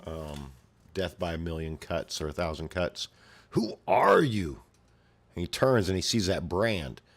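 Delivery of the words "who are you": high, loud, slow monster question; menacing creature. Acted. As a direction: draw it out like a looming beast. Apostle demands of Guts.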